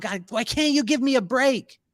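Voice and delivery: frustrated plea. Retold or imitated. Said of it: "and I'm so mad".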